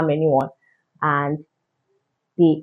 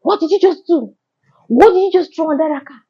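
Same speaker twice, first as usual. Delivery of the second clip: demanding robber; 10 semitones higher than usual. Retold or imitated. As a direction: throw the question at her like an accusation. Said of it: "The guy just started saying".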